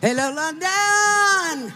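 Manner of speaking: slow, drawn-out greeting, high and loud; calling out to crowd. Real, not acted. Guest speaker greets the London rally crowd, drawn-out loud call. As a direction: stretch it out and call to the whole square.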